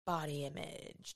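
low, hushed, slow announcement; ominous reveal. Pretend. Host unveiling the daunting topic, mock-ominous hush. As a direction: drop to a spooky hush for the dreaded topic.

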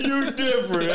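repeating the line while laughing; laughing mimicry. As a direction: crack up while quoting the line.

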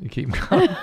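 high, laughing tease; laughing while talking. Real, not acted. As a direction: laugh the words out at your friend. Teasing co-host, laughs through it.

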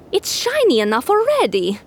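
high, fussy camel voice. Acted. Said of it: "said one camel to another".

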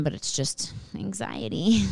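ordinary delivery

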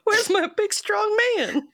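high, swooning damsel voice; playing helpless. Mocking helpless women.

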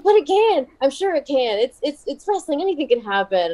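a light impression of a pushy mom; mom insisting. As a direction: play the eager mom who won't let it go. Mom insisting on wrestling plot.

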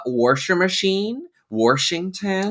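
"washer" and "Washington" as "worsher" and "Worshington"; older people's added R. Retold or imitated. Guest imitating older people like grandpa, R added in "Washington". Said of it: "a lot of older people say".

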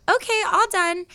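lightly voiced call, lifting at the end; other massage therapist. Massage therapist to the fiance behind the curtain, lightly sing-out call. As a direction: announce it casually, pitch rising on "all done". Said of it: "the lady that was massaging".

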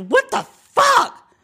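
sit up and let the frustration burst out slowly; yelled out loud. Retold or imitated. Sitting up alone in bed in the dark, drawn-out exasperated yell. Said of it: "sat up and just yelled out loud".

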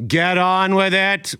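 loud, high, drawn-out call; emphatic cry. Acted. Host hurrying the show along, emphatic cry. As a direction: belt it out at half speed, high.

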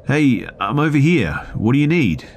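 high, loud call across the room. Retold or imitated. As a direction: shout to a coworker out of sight. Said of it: "so I called out to them".

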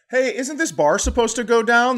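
high, loud panicky question; alarmed as the bar stays up; worried rider. Retold or imitated. Rider on a creationist coaster asks anxiously, high and loud. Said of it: "You're like".

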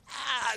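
high, drawn-out mock laugh; imitated toddler laugh. Dad demonstrating his toddler's laugh, high and drawn-out. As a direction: stretch out a high "ah" like a cackling baby. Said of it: "just like that".

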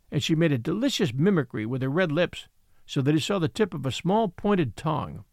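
usual speaking voice

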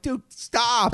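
high, fed-up "Dude, stop"; exasperated. Retold or imitated. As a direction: cut him off, tired of it. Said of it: "I'm like".

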